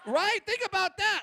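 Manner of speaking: usual speaking voice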